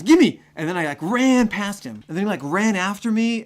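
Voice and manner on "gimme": high, loud, grabbing shout; snatch at it, yelling; frantic guard. Retold or imitated. Security guard demanding the ID back, shrill shout.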